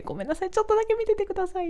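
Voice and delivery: quick, high rushed plea; tossed off while already running; hurried apology. Retold or imitated. Performer dashing off asking staff to mind gear, quick high plea.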